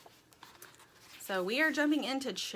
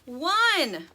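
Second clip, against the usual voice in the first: loud, higher drawn count; calling out; 7 semitones higher, 7 dB louder than usual. A narrator's reading. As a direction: call your number eagerly. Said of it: "called at the exact same time".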